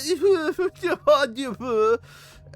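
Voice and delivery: shrill relieved laugh; high nervous laugh. Real, not acted. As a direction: laugh off the fright. Laugh after chase.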